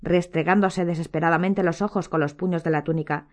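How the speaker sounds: plain reading voice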